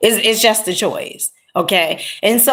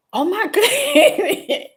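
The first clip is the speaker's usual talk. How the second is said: high, slow, laughing oh my goodness; surprised lady laughing; laughing exclamation; 13 semitones higher, slower than usual. Retold or imitated. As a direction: gasp it out through a laugh. Older lady reacts to her crying.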